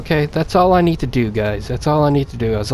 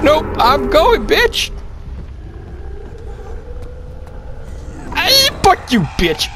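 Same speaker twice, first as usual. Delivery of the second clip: panicked high-pitched taunt; defiant yell; slower than usual. Real, not acted. Yelling at game monster.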